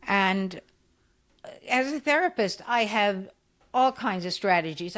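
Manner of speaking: ordinary talk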